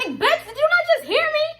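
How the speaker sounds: ordinary talk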